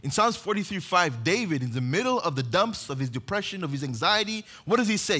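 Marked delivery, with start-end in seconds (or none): none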